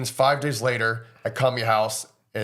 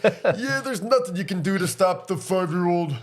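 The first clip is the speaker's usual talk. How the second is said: Ox King; 7 semitones higher than usual. Retold or imitated. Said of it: "I can't really do his voice that well".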